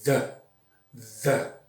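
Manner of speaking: "the" with the tongue between the teeth and a buzz; "the" with a voiced TH, not D. Shown as a demonstration. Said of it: "Eu dou aquele sopro pra dar aquele the".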